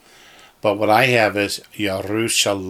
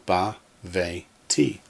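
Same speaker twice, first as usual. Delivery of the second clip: "ba, ve, ti" in three separate syllables; 2 semitones lower, 5 dB quieter, slower than usual. Shown as a demonstration. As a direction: pause between ba, ve and ti. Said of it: "we have three syllables".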